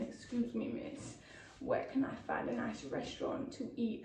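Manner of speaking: hushed, mock-smooth pick-up line; imitating car stranger. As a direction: quote him softly, half under the breath. Retells stranger calling from car window.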